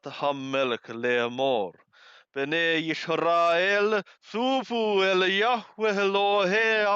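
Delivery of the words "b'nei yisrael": ordinary delivery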